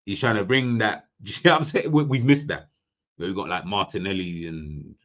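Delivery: normal talking voice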